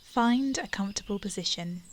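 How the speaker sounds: ordinary talk, nothing marked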